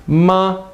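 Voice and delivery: MA held high and level; sing-steady pitch on MA, no bend; first tone. Shown as a demonstration. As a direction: say MA on one high, even note. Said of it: "your voice just stays steady".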